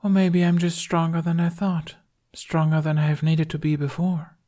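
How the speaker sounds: light and airy, a touch icy. A narrator's reading. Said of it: "in that airy voice of hers".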